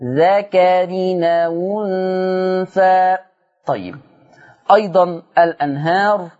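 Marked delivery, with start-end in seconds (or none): 0.0-3.2 slow melodic tajweed chanting; Quran recitation. Acted. Teacher reciting the verse for students, melodic and slow. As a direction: recite the verse slowly, in drawn-out tones.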